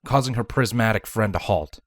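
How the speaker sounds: usual speaking voice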